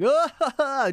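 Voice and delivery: high, loud evil laugh; Voldemort's cackle. Retold or imitated. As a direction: cackle like a dark lord. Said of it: "he's like".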